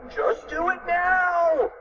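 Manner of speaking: high, slow, drawn-out cry; screamed order. A narrator's reading. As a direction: stretch the command in a high cry. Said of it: "screamed Fluffy".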